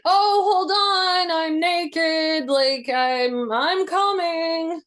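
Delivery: high, slow, fake-casual call through the door; buy time with a breezy sing-song; stalling call. Teen stalling her furious dad through the door, high sing-song call.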